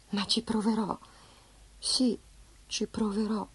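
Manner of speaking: hushed, thinking to herself; soft inner resolve. Acted. Francesca quietly promising herself she'll try. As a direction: say it softly, half to yourself.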